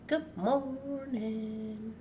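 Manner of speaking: very slow, stretched good morning; drawn-out greeting. Real, not acted. Streamer taking roll call of viewers, drawn-out greeting. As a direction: draw each syllable right out.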